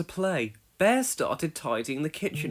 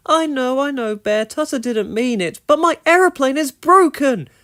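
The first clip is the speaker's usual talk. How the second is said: high, loud whiny complaint; complaining; 9 semitones higher, 11 dB louder than usual. A narrator's reading. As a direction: whine about the broken plane. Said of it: "Ojo complained".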